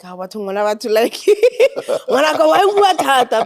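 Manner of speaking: mock exasperation through laughter; laughing sigh about her talkative child; laughing exasperated mother. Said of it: "sometimes it's like".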